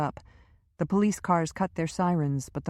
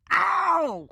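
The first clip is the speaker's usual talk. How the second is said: short, much higher, louder "ow"; cry of pain; 14 semitones higher, 6 dB louder than usual. A narrator's reading. Powers yelping when Lynch squeezes his shoulder again, sharp cry of pain. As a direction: yelp as the grip tightens. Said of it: "Powers grimaced".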